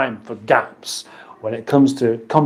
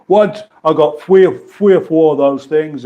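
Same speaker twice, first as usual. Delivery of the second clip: Cockney "three" as "fwee"; punchy mock Cockney; Cockney accent. Shown as a demonstration. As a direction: put on Cockney, TH as F and R softened to W. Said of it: "They kind of go into W's".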